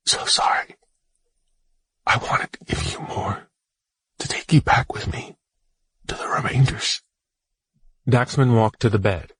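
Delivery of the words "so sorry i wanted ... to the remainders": whisper, rising toward the end; hushed apology, pitch lifting. Acted. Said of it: "whispered Daxman".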